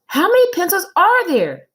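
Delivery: put-on high, loud protesting kid; child Joe. Boy insists to his teacher he counted right, high and indignant.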